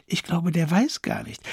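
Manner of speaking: a touch of sobbing; understated sobbing start; mild sob. Acted. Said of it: "schluchzte Charlotte".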